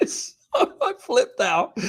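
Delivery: can barely get it out for laughing; cracking up at his own disaster. Real, not acted. Laughing retelling embarrassment.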